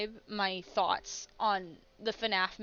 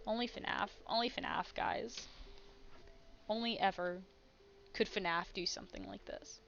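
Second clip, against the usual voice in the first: quiet muttering; 3 semitones lower, 7 dB quieter than usual. Real, not acted. Muttering amused disbelief.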